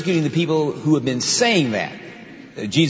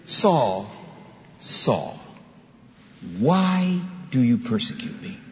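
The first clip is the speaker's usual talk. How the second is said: tender voice of Christ; slower than usual. Acted. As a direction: ask it gently, with affection. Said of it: "he had that experience".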